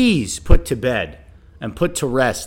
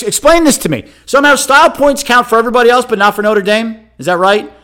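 much higher, louder, fast indignant demand; indignant outburst; 8 semitones higher, 8 dB louder than usual. Real, not acted. Host demanding an answer on Notre Dame style points, indignant and loud. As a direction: challenge the listener, fed up and loud.

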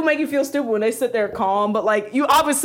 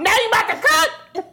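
high, indignant comeback; mad; 12 semitones higher than usual. Retold or imitated. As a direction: snappish mock refusal. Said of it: "So you're mad that I'm calm?".

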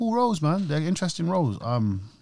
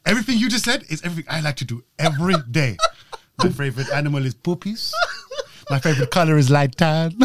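hammed-up comic quote, rushed at first; Nacho; 2 semitones higher, 4 dB louder than usual. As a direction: quote the comic hero, breaking into a laugh at the end. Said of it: "And he's like".

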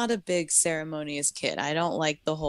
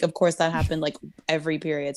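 normal talking voice

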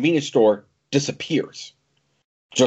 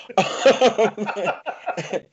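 bursting into laughter; high burst of laughter; 10 semitones higher than usual. Real, not acted. Laughing at own accent.

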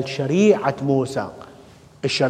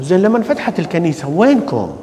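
ordinary delivery

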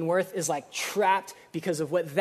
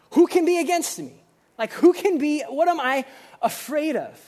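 fervent challenge; 8 semitones higher, 5 dB louder than usual. Real, not acted. Preacher's rhetorical challenge.

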